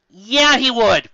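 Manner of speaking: loud, slow scoffing yeah; sarcastic retort. Real, not acted. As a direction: scoff at the text.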